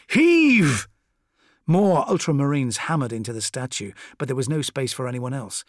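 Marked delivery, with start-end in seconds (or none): 0.1-0.9 very high, loud heaving shout; cried command. A narrator's reading. Corvo urging men pushing the statue, straining cry. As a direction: cry out to drive the push. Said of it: "cried Corvo".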